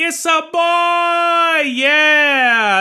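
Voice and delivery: high, slow, loud celebratory shout; over-the-top joyful whoop; excited cheer. Pretend. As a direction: yell it out like a proud fan. Celebrating the baby news.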